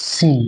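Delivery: সিন with a thin, fine s. Shown as a demonstration. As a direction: keep the s of সিন narrow, not sh. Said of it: "একটু সরু মোটার ব্যাপার আছে".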